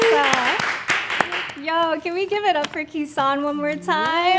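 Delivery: loud, high, excited hype-up; cheering. Real, not acted. Host rallying the audience for the young poet, cheering.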